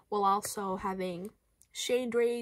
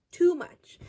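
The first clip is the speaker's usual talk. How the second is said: higher, louder exclaim; loud exasperated too much; 7 semitones higher, 6 dB louder than usual. Acted. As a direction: burst out with it. Repeats louder.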